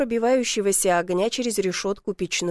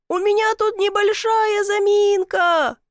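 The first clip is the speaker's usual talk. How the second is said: high, drawn-out holler; muffled yell; 10 semitones higher, 4 dB louder, slower than usual. A narrator's reading. Man stuck in a chimney reporting trouble, muffled yell. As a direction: call from inside a pipe. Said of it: "заорал приглушённо".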